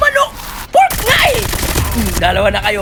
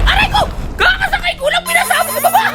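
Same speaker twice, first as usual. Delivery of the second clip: startled yelp. Real, not acted. Car blown up while gaming.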